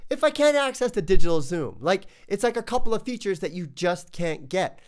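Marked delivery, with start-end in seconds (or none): none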